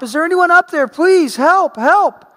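loud, high, pleading call upward; desperate yell for help. Retold or imitated. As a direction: shout up for help, panicked. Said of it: "he yells out up top".

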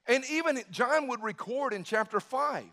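ordinary talk, nothing marked

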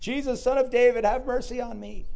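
high, pleading holler; yelling out. Retold or imitated. Blind beggar begs Jesus for mercy, yelling out. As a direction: cry out high to be heard. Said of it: "so he starts yelling out".

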